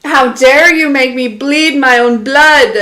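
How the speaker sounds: loud, hammed-up dramatic movie quote; mock movie line. Streamer quoting a movie line to viewers, loud mock outrage. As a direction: quote the film villain with mock outrage, loudly. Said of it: "where he said".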